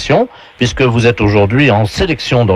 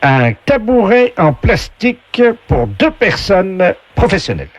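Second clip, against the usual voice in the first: game show voice; 8 semitones higher than usual. Acted. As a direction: announce it like a TV game voice. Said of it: "la voix du jeu".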